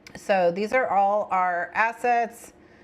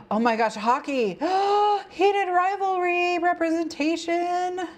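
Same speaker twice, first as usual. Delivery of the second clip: high, squealing fangirl delight; gasp, then squeal it out; gasping excitement; 11 semitones higher than usual. Real, not acted. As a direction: overcome with excitement, pitch climbing high. Gasping delight at hockey art.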